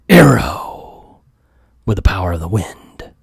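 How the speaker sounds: dramatic hushed whisper; noir whisper. Acted. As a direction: breathe the name like a secret. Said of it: "I'm gonna read it like a noir".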